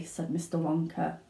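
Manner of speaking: ordinary delivery, nothing marked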